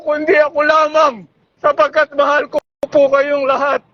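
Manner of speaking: usual speaking voice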